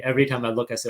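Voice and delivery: ordinary talk, nothing marked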